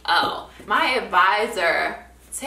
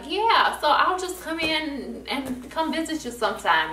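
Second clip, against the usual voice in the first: high, bright brush-off; fake cheerful; 9 semitones higher than usual. Student to therapist, high fake-cheerful brush-off. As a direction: chirp it high to dodge him. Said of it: "So I was just like".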